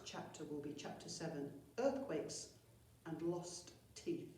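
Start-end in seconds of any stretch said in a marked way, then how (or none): none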